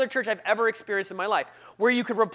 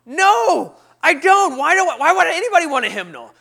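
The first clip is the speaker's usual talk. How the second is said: loud, high mock outburst; hammed-up indignant shouting; exasperated refusal; 9 semitones higher, 12 dB louder than usual. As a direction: yell it, fed up with offers. Retold fending off greeter.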